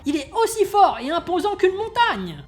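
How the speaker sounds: high, loud boastful shouting; yelling. Acted. The sidekick yelling at Cerise about Big Strongman, loud high boasting. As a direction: bellow his champion's praises. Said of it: "Il se met alors à hurler".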